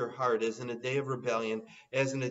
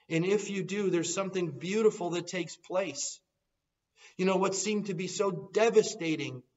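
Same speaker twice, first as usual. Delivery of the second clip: higher, louder, pressing altar call; fervent preaching build; preaching appeal; 4 semitones higher, 3 dB louder than usual. Acted. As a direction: plead with the congregation, building.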